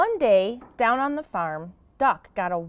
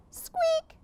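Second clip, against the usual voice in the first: very high, soft squeak; mouse squeak; 6 dB quieter than usual. Acted. Mouse answers Duck with a tiny high squeak.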